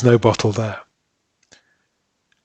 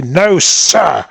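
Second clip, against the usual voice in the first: high, louder defiant cry; 6 semitones higher, 3 dB louder than usual. A narrator's reading. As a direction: refuse him outright, loud. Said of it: "cried the boatswain".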